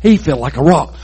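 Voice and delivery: ordinary delivery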